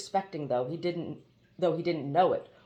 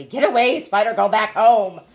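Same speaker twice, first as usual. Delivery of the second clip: much louder, chanted shouts; rhythmic cries; 3 semitones higher, 13 dB louder than usual. Acted. Princess hacking at the spider, crying out rhythmically. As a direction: shout in beats as if hacking with a knife. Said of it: "crying rhythmically".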